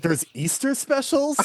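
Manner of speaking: high-pitched mock bafflement; puzzled outsider's question, imitated; imitated disbelief. Quoting baffled normies' reaction.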